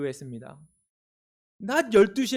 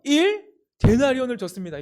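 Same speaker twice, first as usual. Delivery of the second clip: high and loud, leading the answer; bold call-back; 6 semitones higher, 5 dB louder than usual. Shown as a demonstration. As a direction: raise the answer louder. Congregation call and response.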